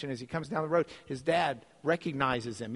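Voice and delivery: usual speaking voice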